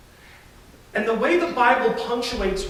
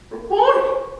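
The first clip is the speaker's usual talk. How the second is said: "Rabboni!" much higher and louder with a rising end, question and exclamation at once; interrobang exclamation; 14 semitones higher, 6 dB louder than usual. Shown as a demonstration. Preacher demonstrating Mary's interrobang "Rabboni!", high, loud, rising. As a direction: say "Rabboni!" as astonished question turned declaration. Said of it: "In this one inflection".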